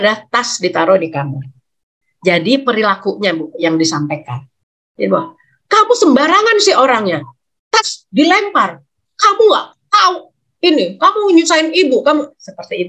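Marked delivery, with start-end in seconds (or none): 5.7-12.4 shrill, high angry mother scolding; high-pitched scolding. Shown as a demonstration. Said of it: "jangan pakai nada tinggi". (ibu memarahi anak dengan nada tinggi)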